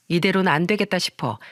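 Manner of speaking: plain reading voice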